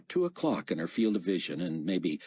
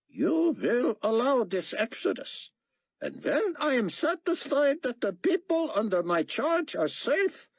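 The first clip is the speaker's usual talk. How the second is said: stern prophet voice; 3 dB louder than usual. Acted. Prophet Chaim commanding Antichrist.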